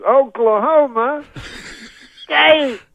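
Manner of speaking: high, loud, slowed-down stadium yell. Retold or imitated. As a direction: stretch it out for the crowd. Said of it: "he says it slower".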